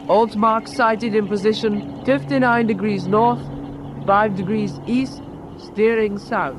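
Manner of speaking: slow, deliberate dictation; measured dictation of coordinates; dictating slowly. Real, not acted. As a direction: spell out the position slowly for the operator. Dictating signal to wireless operator.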